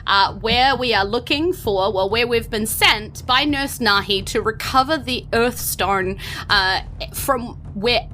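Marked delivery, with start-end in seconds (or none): none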